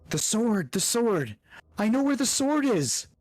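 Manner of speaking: high-pitched excited cry. Acted. Lulu steps back from the spyglass, crying out. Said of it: "starts crying out".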